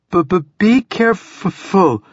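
high, slow shivering stammer; teeth chattering. A narrator's reading. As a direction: stutter through chattering teeth from the cold. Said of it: "teeth chattering now despite her efforts".

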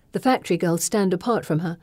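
usual speaking voice

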